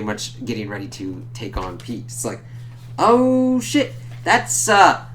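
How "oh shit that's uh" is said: high, loud startled outburst; shocked reaction. Real, not acted. Reviewer reacting to the double Hobgoblin reveal, genuinely shocked. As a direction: react as if the twist just hit you. His own reaction to the twist.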